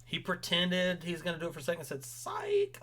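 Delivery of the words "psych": mocking taunt. Mocking taunt in retold quote.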